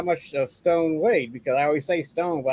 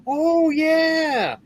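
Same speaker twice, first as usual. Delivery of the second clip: high, bright "oh yeah" of getting it; the penny drops, pitch jumps up; mock dawning realization; sudden realization; 12 semitones higher than usual. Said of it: "and they go".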